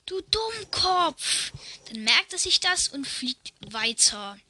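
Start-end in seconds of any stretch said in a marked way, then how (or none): 0.1-1.5 hohes, langsames, gedehntes Schimpfen; Kind schreit. Acted. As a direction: zieh das Schimpfwort hoch und langsam. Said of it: "hört er ein Kind schreien". (Papagei hört ein Kind schimpfen, hoch und gedehnt)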